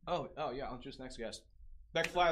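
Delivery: normal talking voice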